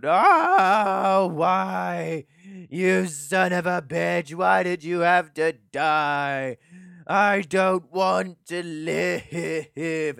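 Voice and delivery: loud, drawn-out hammed-up wailing; mock bawling. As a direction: bawl it out slowly, stretching the vowels like a mourner. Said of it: "they're just bawling their eyes out".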